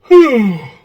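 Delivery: long heavy sigh; loud sigh. Real, not acted. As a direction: let out a big tired sigh. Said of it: "Once I start sighing".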